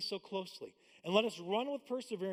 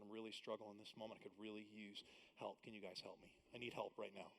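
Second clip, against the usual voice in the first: soft confession; 9 semitones lower, 14 dB quieter than usual. Retold or imitated. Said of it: "this ability to actually just say".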